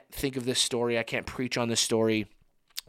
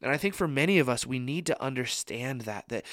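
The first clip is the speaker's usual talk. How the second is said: ordinary delivery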